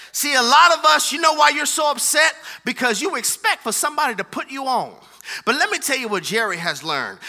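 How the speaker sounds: loud, driving pulpit delivery; preaching at full force. Acted. Pastor firing up his congregation, loud preaching. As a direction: preach it hard to the whole room.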